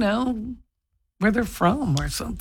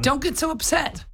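normal talking voice

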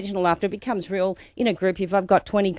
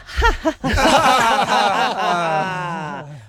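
releasing laugh; 2 semitones lower than usual. Shown as a demonstration. Teacher releasing a tightened face with a big laugh. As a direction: let it all out in a laugh. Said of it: "let it go with a laugh".

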